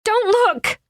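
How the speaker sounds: high, frantic command; urgent shout. A narrator's reading. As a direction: cry it out in panic. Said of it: "she shouted again".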